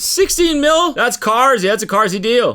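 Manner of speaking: high, loud echoing hype man; high silly hype voice. Pretend. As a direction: cheer him on from the canyon. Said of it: "And he's saying".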